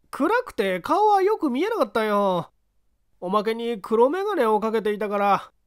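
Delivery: high anxious answer; nervous street tough. Acted. Kinkou answers.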